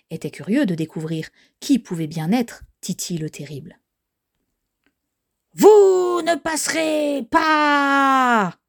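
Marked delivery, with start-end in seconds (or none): 5.5-8.6 high, drawn-out monster shout; shouted warning. Acted. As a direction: bellow each word long and high to block the way. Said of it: "cria Titi le Terrible". (cri menaçant, aigu et traînant)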